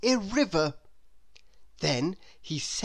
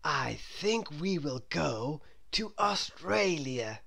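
slow, soft lightly voiced grown-up; lightly voiced guide; Mister Daydream; 5 dB quieter, slower than usual. A narrator's reading. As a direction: announce it slowly. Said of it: "said Mister Daydream".

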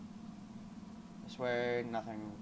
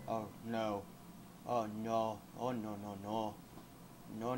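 ordinary talk

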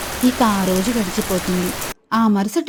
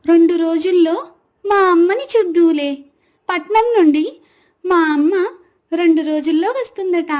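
put-on high, louder child's voice; happy little girl; 7 semitones higher, 5 dB louder than usual. A narrator's reading. Girl telling aunt her mother is coming, high and happy. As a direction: announce it gladly as a little girl. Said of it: "ఆనందంగా".

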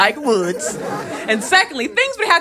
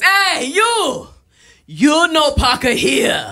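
much higher, slow broken-English yell; 6 semitones higher, much slower than usual. Retold or imitated. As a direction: bark it like an angry lot owner. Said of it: "he yells at me".

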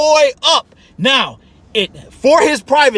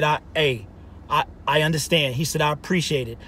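the coach's reply, lower and softer; soft retold reply; 7 semitones lower, 10 dB quieter than usual. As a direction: quietly voice the coach, understated. Voicing the coach's calm reply.

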